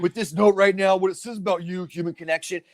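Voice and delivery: lightly mocked gushing emotional guy; Vin Diesel. Actor marvels at gifted wind chimes, mock gushing. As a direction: imitate someone overcome by a small gift. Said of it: "he's walking through the woods going".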